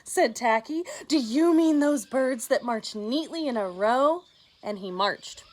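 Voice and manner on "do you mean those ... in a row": loud eager question. Acted. Tacky loudly asks the hunters about marching birds.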